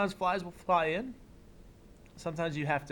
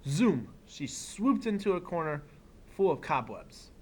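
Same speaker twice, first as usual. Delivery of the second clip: put-on reading to children; 3 dB quieter than usual. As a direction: read it like story time for a class. Reads picture book aloud.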